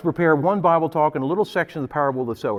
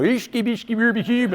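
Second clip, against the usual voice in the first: lightly voiced Muppet Swedish chef gibberish; imitating the Swedish cook babbling nonsense, a touch higher; playful mock chef gibberish; 3 semitones higher than usual. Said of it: "Sounds like the Swedish cook on the Muppet Show".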